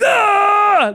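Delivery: very high, loud outburst; acted frustrated scream. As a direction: burst out screaming mid-explanation.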